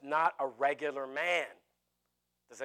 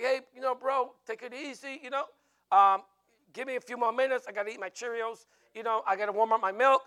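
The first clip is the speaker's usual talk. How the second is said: higher, casual mock plea; mock pleading excuses; 6 semitones higher, 4 dB louder than usual. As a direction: play a laid-back slacker stalling for time. Mimics a slave making casual excuses.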